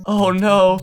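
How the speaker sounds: a touch of mock tearfulness; imitating him on the edge of crying; light, retold borderline sob; near tears. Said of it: "like, almost crying".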